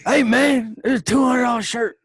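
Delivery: high, loud raspy wrestler promo; Jake the Snake voice. Retold or imitated.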